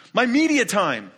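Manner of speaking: put-on high, loud pleading child voice; kid pestering dad. Child pestering his dad, high and loud. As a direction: whine it like an eager kid begging dad for attention. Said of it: "do your kids do this?".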